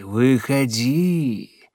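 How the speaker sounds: slow coaxing call, falling at the end; luring call. Acted. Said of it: "зазывал голос".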